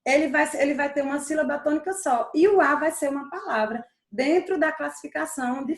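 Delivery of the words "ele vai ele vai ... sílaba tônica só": ordinary talk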